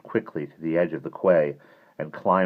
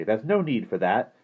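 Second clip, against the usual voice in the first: mildly indignant objection; affronted boy's protest; 6 semitones higher, 4 dB louder than usual. A narrator's reading. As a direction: stand your ground, a little louder and higher. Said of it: "said Simon indignantly".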